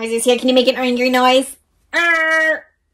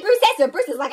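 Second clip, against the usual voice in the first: ordinary delivery, nothing marked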